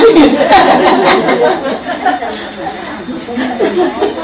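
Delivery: long real laugh at her own joke; real laugh after mocking agreement. Real, not acted.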